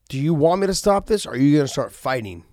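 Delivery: loud, high challenge from a coach; coach's fire-up. Retold or imitated. Coach lighting a fire under a lagging fighter, loud challenge. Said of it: "your coach telling you to light a fire".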